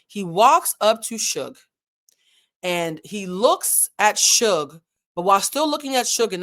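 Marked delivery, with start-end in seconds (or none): 0.0-4.8 slow, deliberate telling; stretched out, weighty pacing; slow for effect. Shown as a demonstration. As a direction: draw each word out for suspense. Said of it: "I'm gonna say this slow".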